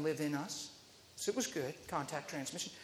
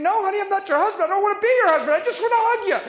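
higher, louder and fast, half shouting in protest; exasperated outburst; 12 semitones higher, 10 dB louder, much faster than usual. Retold or imitated. Preacher protests to the lady that he only wants a hug, exasperated. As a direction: blurt it out, fed up and laughing at the mix-up.

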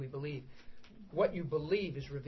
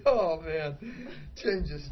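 ordinary talk, nothing marked

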